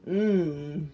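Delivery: usual speaking voice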